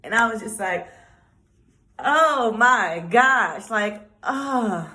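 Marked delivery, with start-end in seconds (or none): 2.0-4.9 slow, louder, drawn-out amazement; amazed gasp. Real, not acted. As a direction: let it sink in, stunned. Overwhelmed by blessing.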